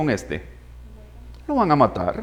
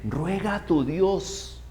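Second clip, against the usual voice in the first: softer, slow, beaten pharaoh's plea; pharaoh pleading; 12 dB quieter, much slower than usual. Retold or imitated. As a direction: beg quietly, slowly, defeated. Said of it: "constantemente Faraón le dice". (Faraón suplicando a Moisés, bajo y lento)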